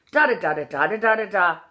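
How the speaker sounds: "Da da da" as an upward Irish lilt; rising inflection. Shown as a demonstration. Coach modelling the inflection, lilting "Da da da". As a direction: chant the tune of "Da da da", aimed upward. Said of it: "It mostly goes up".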